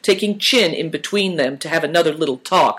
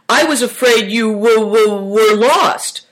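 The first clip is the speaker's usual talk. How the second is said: loud, high, stammering cry; 7 semitones higher, 5 dB louder than usual. A narrator's reading. Uncle Chen who feared the boys were lost, crying out. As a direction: cry out, stumbling and drawing out the words. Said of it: "cried Uncle Chen".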